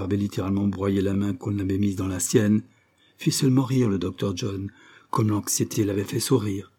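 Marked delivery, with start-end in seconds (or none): none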